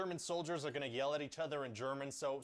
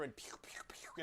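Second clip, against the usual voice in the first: mock laser sounds; 8 dB quieter than usual. Imitating laser blasts in fight.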